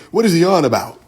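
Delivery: lightly mocking proper talker. Teasing the audience about how somebody talks, lightly mock proper. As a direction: put on a touch of proper speech. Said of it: "Somebody talk like that".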